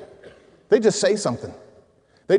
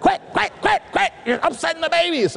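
very high shrill mock scolding; scolding women; 10 semitones higher than usual. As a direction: shoo them off like fussing aunts. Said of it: "all the women in the family go".

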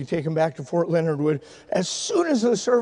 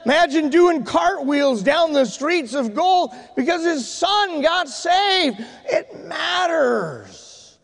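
high, drawn-out preacher's cry; full-force preaching; 10 semitones higher, 3 dB louder, slower than usual. Real, not acted. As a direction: preach it to the rafters, stretching the last words.

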